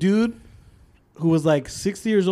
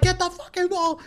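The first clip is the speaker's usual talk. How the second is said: urgent call; 12 semitones higher, 3 dB quieter than usual. Retold or imitated. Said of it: "I'm like".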